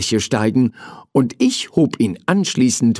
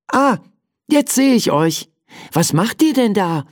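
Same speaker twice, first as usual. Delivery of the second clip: surprised discovery; 11 semitones higher than usual. Acted. Child discovers the toys, asks.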